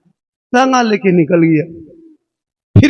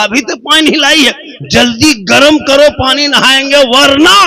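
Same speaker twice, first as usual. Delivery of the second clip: bossy order; 7 semitones higher, 3 dB louder than usual. Acted. Husband orders wife angrily.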